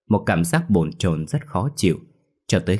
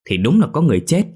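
put-on insistent kid; 8 semitones higher, 6 dB louder, faster than usual. Lì insists, child voice.

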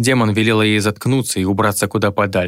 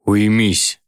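menacing growl. A narrator's reading. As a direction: snarl it as a threat. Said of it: "грозно рыкнул на ухо".

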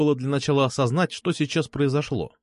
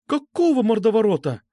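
high-pitched startled woman's voice; alarmed exclamation; 9 semitones higher, 4 dB louder than usual. A narrator's reading. Alfa hears of a thug escort, alarmed exclamation. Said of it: "воскликнула Альфа".

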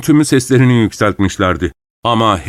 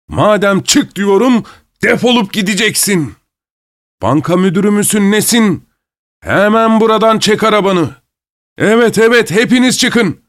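furious masked man; 11 semitones higher than usual. A narrator's reading. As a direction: spit the insults back in outrage.

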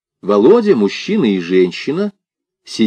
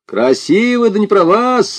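high, jeering cry; shouting back; 12 semitones higher than usual. A narrator's reading. Local lad warns strangers off the girls, shouting. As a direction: throw it back high and scornful. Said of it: "закричал парень".

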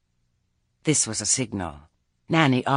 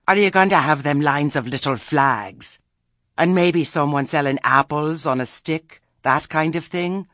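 louder, higher, needling questions; pointed old Granny; 6 semitones higher, 8 dB louder than usual. Acted. Granny quizzing the fete committee, pointed. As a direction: press them about the details.

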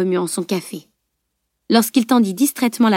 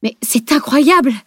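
higher, indignant outcry; astonished exclamation, swelling at the end; exclaiming in disbelief; 5 semitones higher, 4 dB louder than usual. A narrator's reading. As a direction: burst out, dumbfounded. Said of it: "s'écria-t-il".

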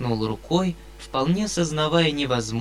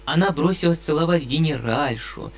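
usual speaking voice

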